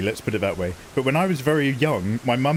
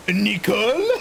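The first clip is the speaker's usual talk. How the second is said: higher sing-song Nicole from the advert; Renault ad Papa; 7 semitones higher, slower than usual. Retold or imitated. Quoting the old Renault Clio advert to chat, sing-song. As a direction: mimic the father calling Nicole. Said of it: "It's a car advert".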